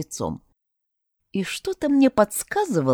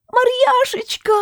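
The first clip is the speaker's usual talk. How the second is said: slow, much higher squeal of joy; high-pitched wail. A narrator's reading. Little creature greeting the heroine, slow high wail. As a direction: stretch the name out in a high wail. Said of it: "завопил на высокой ноте Пикси".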